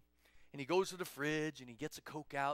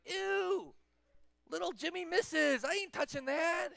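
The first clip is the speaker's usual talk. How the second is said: high-pitched disgusted refusal; hammed-up revulsion; grossed-out kid; 11 semitones higher, 3 dB louder, slower than usual. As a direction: squeal and refuse like a child. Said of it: "And you're like".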